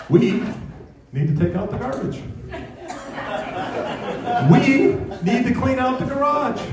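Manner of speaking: high-pitched mock wifely "we"; imitated wife. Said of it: "she'll say things like".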